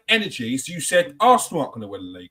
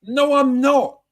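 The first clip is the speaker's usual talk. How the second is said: firm denial; 8 semitones higher, 6 dB louder, much slower than usual. Real, not acted. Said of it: "insistent reply".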